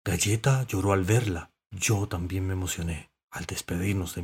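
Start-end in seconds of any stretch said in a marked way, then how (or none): none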